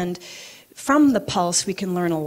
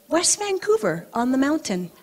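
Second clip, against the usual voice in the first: high, singsong friend's voice; very high, lilting imitated reply; Janet; 7 semitones higher than usual. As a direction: imitate a friend answering, pitched way up. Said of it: "And she said".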